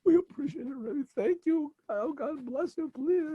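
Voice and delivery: high, sobbing gratitude, slowing; crying thanks. Acted. Couple blessing the Rebbe through sobs. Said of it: "They're crying".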